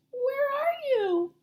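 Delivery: put-on high tiny squeak of a lost baby; squeaky baby bat. A narrator's reading. Lost baby bat calling for her mother, high squeak. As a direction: squeak it out, small and scared. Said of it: "Stellaluna squeaked".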